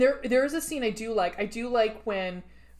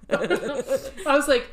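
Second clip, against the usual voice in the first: loud laughter, words slowed; real laughter; laughing hard; 5 semitones higher, 7 dB louder, much slower than usual. Real, not acted. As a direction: crack up through the words.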